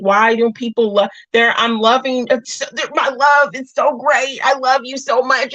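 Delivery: mock her old self begging to be loved; pleading past self. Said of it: "I used to think".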